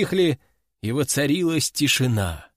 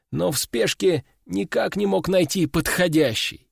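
ordinary delivery, nothing marked

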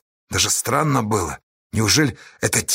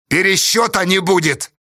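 higher, decisive declaration; firm refusal; 7 semitones higher, 3 dB louder than usual. A narrator's reading. Astasha refusing the crowd a recount, firm. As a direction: lay it down firmly. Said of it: "твёрдо сказал Асташа".

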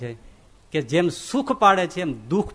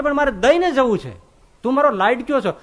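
higher, louder loving insistence; God's affectionate insistence; 7 semitones higher, 5 dB louder than usual. Retold or imitated. God insists to his beloved sadhu, higher and louder. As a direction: coax him fondly but firmly. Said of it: "ભગવાન કે".

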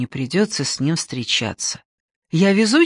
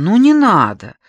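pleading whine; 5 semitones higher, 7 dB louder than usual. Acted. Daughter pleading with mother.

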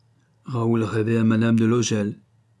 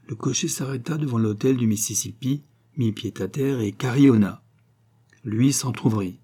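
usual speaking voice

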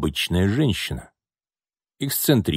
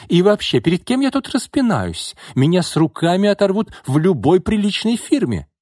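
very high, indignant tirade; shouting; 11 semitones higher, 4 dB louder than usual. A narrator's reading. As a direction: shout with offended pride before walking out. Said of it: "крикнул архитектор". (возмущённый крик)

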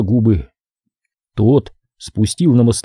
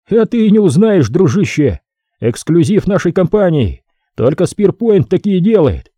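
higher, eager boasting; proud boast; 7 semitones higher than usual. Acted. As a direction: show off the company gun. Mike brags.

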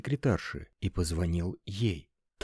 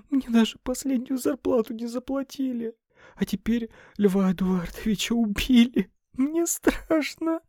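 tearful; 4 dB louder than usual. A narrator's reading. Frightened secretary to her boss, tearful. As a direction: cry through every word. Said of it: "снова заплакала Лена".

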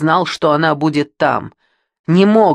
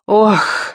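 higher, relieved "oh"; sigh of relief; 6 semitones higher, 4 dB louder than usual. A narrator's reading. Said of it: "с облегчением вздохнула миссис Таунли Янг". (вздох облегчения)

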